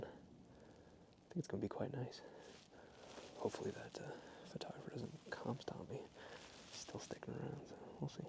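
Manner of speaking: hushed whisper so the other photographer won't hear. Real, not acted. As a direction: keep it to a whisper. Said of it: "I gotta whisper".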